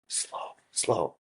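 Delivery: urgent voiceless whisper; whispered warning. Retold or imitated. Contestant signalling the slow counter, whispered warning. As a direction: whisper it across a room.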